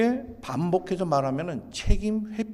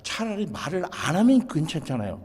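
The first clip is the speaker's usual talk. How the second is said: ordinary talk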